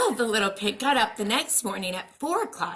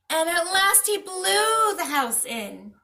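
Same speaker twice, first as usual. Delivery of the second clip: ordinary delivery, nothing marked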